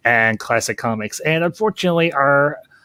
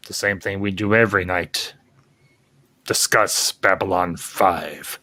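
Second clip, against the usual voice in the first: Brain-style schemer; 6 semitones lower than usual. Pretend. Pinky and the Brain parody answer.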